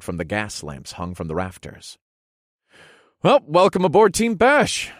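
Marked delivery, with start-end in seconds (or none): 3.2-4.9 loud, high celebratory call; hearty welcome. Acted. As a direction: welcome the newcomer before a toast. Toast welcoming new member.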